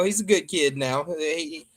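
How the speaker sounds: ordinary delivery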